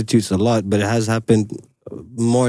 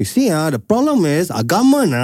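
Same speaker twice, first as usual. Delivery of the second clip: higher-pitched Eurasian uncle with a complaint; Eurasian uncle accent; 5 semitones higher than usual. Retold or imitated. Uncle explaining the problem with government, Eurasian uncle accent. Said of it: "That is a Eurasian uncle accent".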